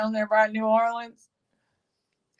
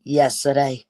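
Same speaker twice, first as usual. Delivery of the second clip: Yesterday said low in a Cajun accent; 6 semitones lower than usual. Shown as a demonstration. Repeating the word Yesterday in Cajun accent. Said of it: "That's my Cajun accent".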